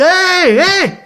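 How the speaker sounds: two high, held calls; mock yell. Storyteller rousing a sleeping stranger, high mock yell. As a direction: call out "hey, hey" high, not truly shouting. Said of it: "Je gueule".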